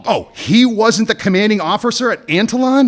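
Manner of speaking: high-pitched, scornful challenge; heated challenge. Acted. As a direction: raise the voice and confront them. Challenges her in acted quarrel.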